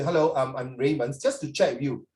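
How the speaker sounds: usual speaking voice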